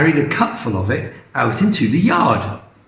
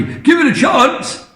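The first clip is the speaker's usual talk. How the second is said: much higher, pleading; impatient Mister Cranky; 12 semitones higher than usual. Acted. Mister Cranky defending the medicine, high insistent. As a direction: beg her to wait and see.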